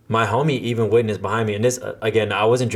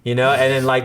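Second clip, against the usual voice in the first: ordinary delivery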